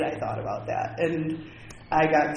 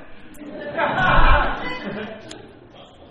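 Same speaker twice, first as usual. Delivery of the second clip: real laugh, much higher and louder; 12 semitones higher, 7 dB louder than usual. Real, not acted. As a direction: laugh out hard at your own story. Laughing at own retold line.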